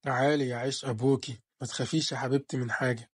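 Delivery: low, soft and protective; a father soothing his scared little girl; tender father. Acted. Father comforting frightened daughter. (أب حنون بصوت واطي وهادي)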